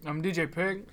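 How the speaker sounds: talking through a mouthful; words around a bite of donut; mouth full. Real, not acted. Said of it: "D J is eating something".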